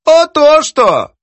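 high, drawn-out exclamation; triumphant. Acted. Poetess catches editor out on his verse, triumphant. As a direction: pounce on it, stretching it out high.

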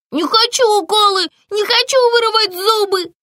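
high, scared protest; a little boy refusing injections; frightened child. A narrator's reading. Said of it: "испугался малыш". (испуганный детский голос)

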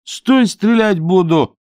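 high, loud, drawn-out threat; shouted warning. A narrator's reading. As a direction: yell it slowly at someone escaping. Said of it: "закричал Чонкин".

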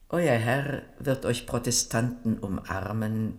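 normal talking voice

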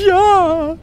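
high, loud snivelling ghost; tearful sniffling. Acted. Weeping ghost answers Mathilda, sniffling. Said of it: "schnieft das Gespenst".

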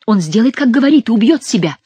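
urgent, agitated protest; alarmed. A narrator's reading. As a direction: blurt it out in alarm. Diana alarmed by his threat.

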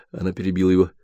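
usual speaking voice

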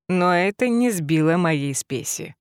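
ordinary delivery, nothing marked

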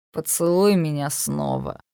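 put-on low, slow, drunken sneer; nasty drunk voice. A narrator's reading. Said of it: "противным пьяным голосом".

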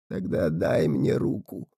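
low, slow, soft otherworldly voice; a whispered cold offer; eerie spirit boy. A narrator's reading.

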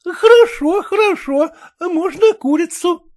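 заметно выше, старушечья интонация; тётушка охотно соглашается; голос тётки. Acted. Тётка отвечает племяннику. (высокий голос тётки)

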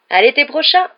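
loud, high call rising at the end; shouted farewell. A narrator's reading. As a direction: call out goodbye across a distance. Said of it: "leur cria Harry". (cri d'adieu lancé de loin)

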